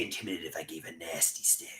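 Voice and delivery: menacing whispered tease. Real, not acted. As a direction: breathe it out to spook the chat. Teasing chat after stare demo.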